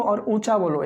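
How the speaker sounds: ordinary talk, nothing marked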